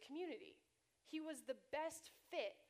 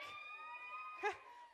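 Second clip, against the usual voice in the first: high laugh. Real, not acted. Laughing at the word ship.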